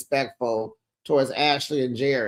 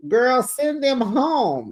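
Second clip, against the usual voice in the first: higher, slow, insistent "send them home"; emphatic advice; 8 semitones higher, slower than usual. Real, not acted. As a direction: spell it out like it's obvious. Advising emphatically.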